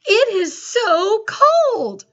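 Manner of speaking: high, loud, slow It is so cold; excited exclamation. Shown as a demonstration. Teacher showing the class an exclamatory sentence, high and loud. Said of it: "Listen to how I say it today".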